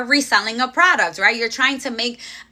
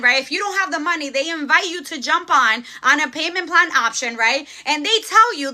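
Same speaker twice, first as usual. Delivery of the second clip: usual speaking voice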